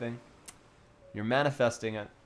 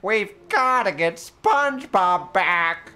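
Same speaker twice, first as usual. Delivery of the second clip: cartoon character voice; 6 semitones higher, 7 dB louder, much slower than usual. Voicing SpongeBob cartoon scene.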